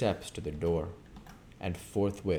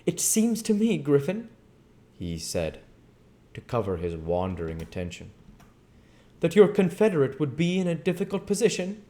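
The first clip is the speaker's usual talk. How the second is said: a lightly voiced cautious Kemp, higher; Kemp stalling; 10 semitones higher, 6 dB louder than usual. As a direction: stall for time in a raised voice.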